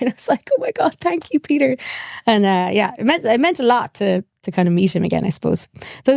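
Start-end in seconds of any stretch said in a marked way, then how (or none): 0.5-1.8 high, weepy thanks, acted out; mock tearful. Thanking the man who got her home, mock tearful. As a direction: imitate bursting into grateful tears. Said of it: "I burst out crying".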